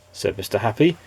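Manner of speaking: usual speaking voice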